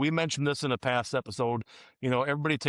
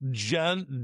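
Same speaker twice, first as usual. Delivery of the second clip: normal talking voice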